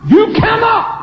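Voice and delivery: high shouted preaching; shouting. Real, not acted. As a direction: shout it out from the pulpit.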